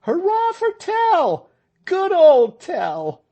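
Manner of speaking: high, loud, slow cheer; crowd cheering. A narrator's reading. The crowd cheering Tell after his shot, loud jubilant cheer.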